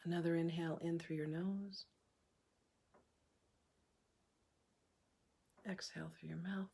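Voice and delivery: soft, unhurried meditation voice with long pauses; hushed, calm breathwork leader; guided meditation. Acted. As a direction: guide them gently through each breath, leaving room to breathe.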